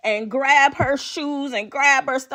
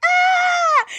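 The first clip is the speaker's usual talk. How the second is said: imitated girl's scream. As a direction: shriek it like the girl by the recliner. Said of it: "this girl starts screaming".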